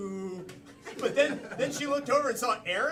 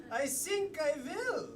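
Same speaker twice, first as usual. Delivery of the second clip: higher-pitched, put-on German accent; 4 semitones higher, 6 dB quieter than usual. Improviser told to keep saying the accent, mock German. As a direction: answer with a stage German accent.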